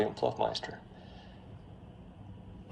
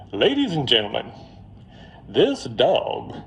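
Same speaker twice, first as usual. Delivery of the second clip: put-on louder lawyer's opening in a Mississippi accent; 6 dB louder than usual. Lawyer opening to the jury, put-on Mississippi accent. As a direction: put on a Mississippi courtroom drawl. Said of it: "it's my Mississippi accent".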